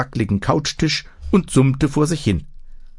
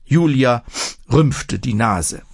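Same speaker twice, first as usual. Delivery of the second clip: usual speaking voice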